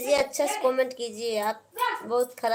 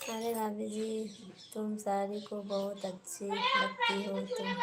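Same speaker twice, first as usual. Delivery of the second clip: much softer, slow reread of अरे भाभी जी; 9 dB quieter, slower than usual. Acted. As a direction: murmur अरे भाभी जी quietly and slowly. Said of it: "rereads the same comment, hushed". (धीमी, धीरे से दोहराई गई टिप्पणी)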